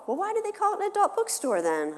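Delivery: put-on high curious child voice; a kid pressing mom in the van, high and curious; child's voice.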